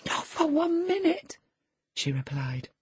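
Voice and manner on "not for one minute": very high, anguished refusal; desperate refusal. Acted. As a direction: refuse to let him go. Woman refuses to release him.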